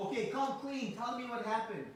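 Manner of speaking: interrogating mother. Acted. Mother questions Krishna about the broken pot, demanding. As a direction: act a mother demanding a confession.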